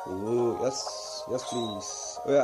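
ordinary talk, nothing marked

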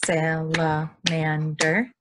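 Salamander stretched out slowly beat by beat; syllable by syllable. Shown as a demonstration. Teacher counting beats for kids, slow syllables. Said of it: "remember how they said salamander was four".

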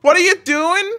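high-pitched horrified question; acted alarm. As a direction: cry it out in acted fright. Character alarmed by the explosive.

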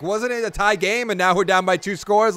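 usual speaking voice

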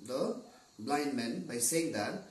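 ordinary delivery, nothing marked

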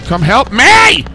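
loud, high urgent call; shouting for help. Real, not acted. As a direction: yell for help across the map. Downed, calling teammates for help.

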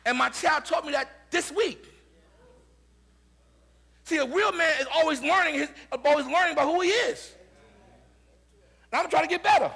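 loud, high-pitched preaching; prédica enérgica. Acted. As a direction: preach it out at full force to the congregation. Preaches at full force.

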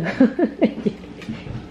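breathy, voiceless chuckling; quick wheezy laugh. Real, not acted. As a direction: laugh with hardly any voice. Amused at the child.

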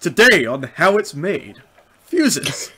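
slow, loud documentary narrator; TV announcer. Pretend. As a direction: announce it like a TV show intro. Parodying TV show intro.

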